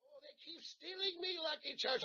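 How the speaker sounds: a second lighter Irish accent take. Shown as a demonstration. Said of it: "Irish accent".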